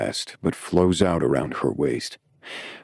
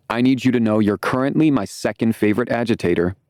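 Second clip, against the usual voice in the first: higher, playful and teasing; flamboyant friend voice; 7 semitones higher, faster than usual. A narrator's reading. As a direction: lean in and flirt with it. Max voiced close to screen.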